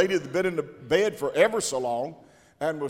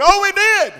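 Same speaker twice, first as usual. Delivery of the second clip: high, loud shouted answer; loud exclaimed reply; 13 dB louder than usual. Retold or imitated. Preacher retelling his own shouted reply to the man. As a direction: fire back the answer.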